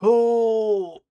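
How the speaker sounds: high strained groan mid-stretch. Real, not acted. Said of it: "I'm just stretching now".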